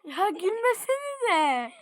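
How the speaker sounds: slow, high plea broken by laughter; laughing through a loud "stop laughing"; laughing scold. Real, not acted. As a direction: try to be stern but crack up. Scolding brothers while laughing himself.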